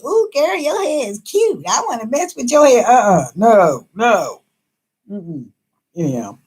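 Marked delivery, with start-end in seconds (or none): 0.1-2.9 mock sweet-talking hairdresser, higher and a bit rushed; lightly voiced salon girl angling to touch your hair; gushing stylist. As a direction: coo it like a pushy stylist flattering you. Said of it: "because they tell you".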